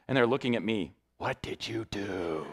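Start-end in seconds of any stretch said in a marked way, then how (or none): 1.2-2.5 low, slow, soft mock accusation; suspicious onlookers. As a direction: draw it out low, imitating baffled officials. Judge and defender staring, retold.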